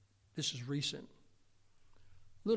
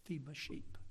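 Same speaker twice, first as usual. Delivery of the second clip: quiet command; 10 dB quieter than usual. Retold or imitated. The Lord answering Peter, softer. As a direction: say it gently but firmly. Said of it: "He said".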